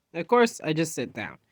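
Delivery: plain reading voice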